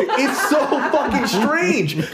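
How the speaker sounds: ordinary delivery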